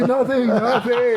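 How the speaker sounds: high, stretched-out whimper; hammed-up fearful fib; mock panicked denial. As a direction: deny it in a comically high, slow squeal. Retold panicked denial, laughter around.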